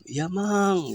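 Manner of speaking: high answering voice; voice from the well. Acted. Dead friend answering the rich man from the well, high.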